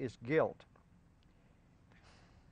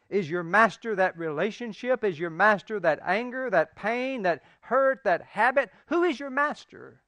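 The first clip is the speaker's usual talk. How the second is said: louder and higher with each question; building rhetorical crescendo; preaching climax; 6 semitones higher, 6 dB louder than usual. Acted. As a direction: hammer the questions home.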